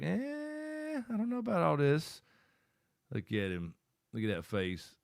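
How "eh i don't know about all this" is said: put-on much higher, doubtful little baby voice; baby's thoughts voiced. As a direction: voice the baby's skeptical thought, pitched way up. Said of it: "that one's like".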